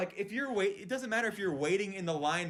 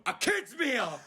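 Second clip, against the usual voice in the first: mock shout; 9 semitones higher, much slower than usual. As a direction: snap the order out like a fed-up customer. Impatient customer repeating his order.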